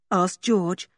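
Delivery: plain reading voice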